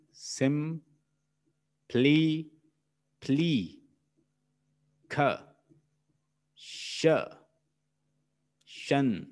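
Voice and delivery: "Sim, pli, kha, shan" separated by clear pauses; syllable by syllable. Shown as a demonstration. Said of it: "So how many?".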